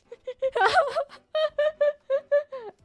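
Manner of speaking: long, loud high-pitched laugh. Real, not acted. Streamer embarrassed by the fish story, high laughter. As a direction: burst into helpless high laughter.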